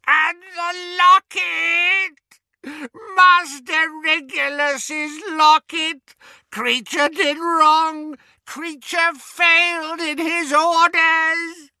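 very high, slow, shrieking wail; anguished elf's shriek; screaming elf. Acted. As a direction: scream out his guilt. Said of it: "uttered a blood-curdling scream".

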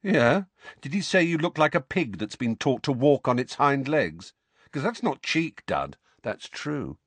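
high, sneering taunt; mocking. A narrator's reading. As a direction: jeer at him with a grin. Harry mocking Dudley.

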